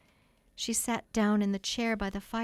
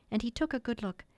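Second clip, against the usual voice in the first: usual speaking voice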